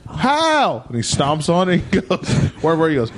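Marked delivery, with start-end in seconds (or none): 0.1-0.8 high coach yelp; Coach McCullough. Retold or imitated. Coach asks the student how, high incredulous coach voice. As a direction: fire back one high, baffled word. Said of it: "That's exactly how he sounds".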